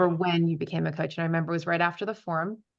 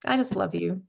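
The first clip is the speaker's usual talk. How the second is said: holding back tears; slower than usual. Real, not acted. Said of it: "I'm gonna cry".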